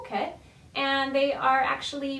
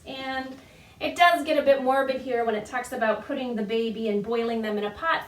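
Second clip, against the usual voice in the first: ordinary talk, nothing marked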